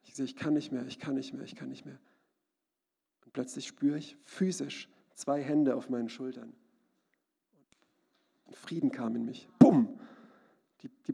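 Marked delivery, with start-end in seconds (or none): 0.2-2.0 quiet, fading repeated plea, recalled despair; retold desperate cry. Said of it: "ich habe geschrien". 9.6-10.0 loud "bumm", falling sharply; sudden boom. Retold or imitated. Sudden impact of peace arriving.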